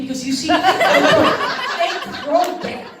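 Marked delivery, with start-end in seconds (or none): 0.5-1.8 a burst of high laughter, louder; cracking up loudly at own joke. Real, not acted. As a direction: break into a loud high laugh.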